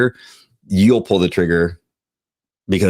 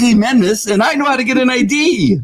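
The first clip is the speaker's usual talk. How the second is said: shouting. Real, not acted. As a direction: yell it, fed up and offended. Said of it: "Sorry, I'm yelling".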